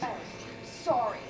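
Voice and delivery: small, low, soft apology; subdued apology. Acted. Wife corrected on her volume, subdued apology.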